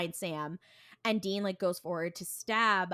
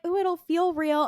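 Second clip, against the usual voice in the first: high, louder put-on enticement; hammed-up high temptress coo; mock seductresses; 10 semitones higher, 6 dB louder than usual. Two women coaxing Dean, mock seductive. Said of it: "they're trying so hard".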